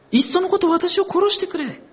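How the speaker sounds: high, worn-out wish to die, lightly acted; despairing plea. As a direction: voice a man at his limit. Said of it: "こんな弱音吐いて". (弱音を吐く高めの絶望の声)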